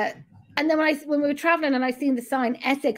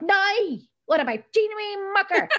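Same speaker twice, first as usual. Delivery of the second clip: put-on high-pitched joke Northern Irish accent; mock Northern Irish; 6 semitones higher than usual. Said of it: "Northern Irish".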